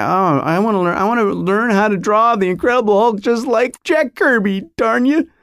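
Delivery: mock childish frustration. Boy trying to draw like his comic idol, mock frustration. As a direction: build to a high-pitched mock outburst.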